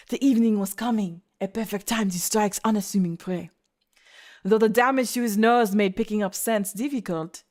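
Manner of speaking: put-on French-accented story narration; English read with French-accented vowels and consonants. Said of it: "no, he's French".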